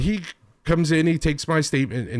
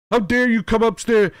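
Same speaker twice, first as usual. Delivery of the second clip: high, louder outraged repeat; indignant mock yell; 7 semitones higher, 4 dB louder than usual. Teenage wrestler yelling at the party host, mocked. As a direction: sneer it back like a puffed-up teenager. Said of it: "And he's like".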